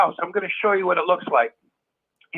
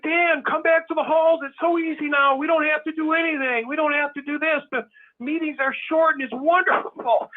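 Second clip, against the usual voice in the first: mock recruiter; 6 semitones higher than usual. A hint of the old friend urging Dan back. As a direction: lightly ham up the cheerful recruiter. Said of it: "He's like".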